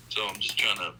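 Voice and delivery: usual speaking voice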